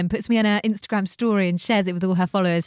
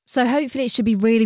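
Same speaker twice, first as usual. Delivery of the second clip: ordinary talk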